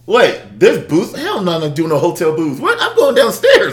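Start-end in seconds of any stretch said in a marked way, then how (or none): 2.6-3.7 high, louder, eager joke; excited. Real, not acted. Man eager for hotel booze, high excited joke. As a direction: jump up for the booze.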